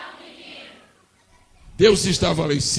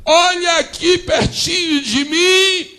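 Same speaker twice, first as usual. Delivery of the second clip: high, slow, shouted tears of joy; mother in the desert discovering the spring, shouting and weeping; Agar's joyful cry; 11 semitones higher, 6 dB louder, much slower than usual. Acted. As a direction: cry out in disbelief at the water. Agar finds the spring, crying out.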